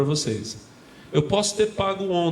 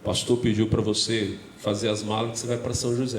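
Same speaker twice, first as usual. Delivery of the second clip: a hint of a lower, solemn pastor; lightly voiced older pastor; 7 semitones lower than usual. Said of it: "esse pastor olhou pra mim e falou assim".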